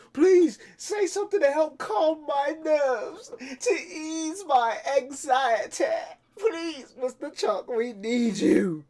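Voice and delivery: very high, tearful pleading; high crying fan voice; hysterical fan. Acted. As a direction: beg him to calm your nerves.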